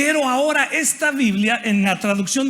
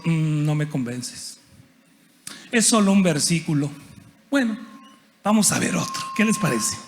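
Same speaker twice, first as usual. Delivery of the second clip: skeptical lawyer; 6 semitones lower than usual. Retold or imitated. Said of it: "los abogados siempre decimos".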